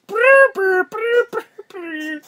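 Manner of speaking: high buzzing bagpipe drone with the lips; bagpipe drone imitation. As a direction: buzz like a bagpipe warming up. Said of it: "The bagpipes are one thing".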